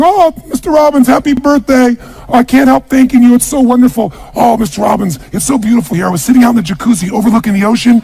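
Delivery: high-pitched, delighted housekeeper impression; gushing maid. Maid phoning birthday wishes from the castle, gushing. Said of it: "my maid, she's back at home in the castle going".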